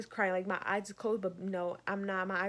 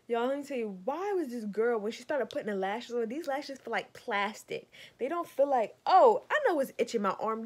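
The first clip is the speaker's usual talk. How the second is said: normal talking voice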